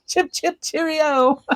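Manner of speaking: high, hammed-up posh English "chip, chip, cheerio"; playful mock English toff; mock British accent. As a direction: tease him with a silly British voice. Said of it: "Every time Ravi gets on, I'm like".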